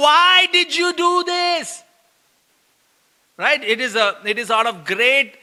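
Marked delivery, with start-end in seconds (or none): 0.1-1.8 high, slow, drawn-out accusing cry; aggrieved parental wail; anguished complaint. Shown as a demonstration. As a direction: stretch it out in exasperation. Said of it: "This is not what we are".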